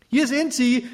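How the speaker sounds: high, loud call across the meadow; girl calling that she found the goats. A narrator's reading. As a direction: shout to him from afar. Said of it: "rief sie nach einer Weile laut".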